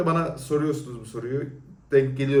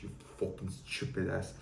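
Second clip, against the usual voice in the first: muttered insult; 5 semitones lower, 11 dB quieter than usual. Real, not acted. Insults commenter under breath.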